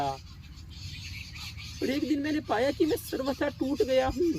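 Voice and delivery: high, distraught cry of despair; broken anguish. Acted. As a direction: pitch climbing as the character falls apart. Character confesses he is utterly broken.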